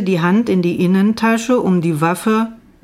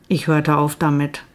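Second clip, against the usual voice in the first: plain reading voice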